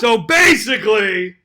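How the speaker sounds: loud, high, drawn-out shout; stretched-out shouted interruption; talking over someone. Real, not acted. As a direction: drown out the objection, slowly and loudly. Talks over the co-host's protest.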